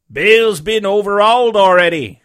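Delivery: high, loud henchman voice; pirate reporting. A narrator's reading. Searcher at inn door to leader, high loud report. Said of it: "said he".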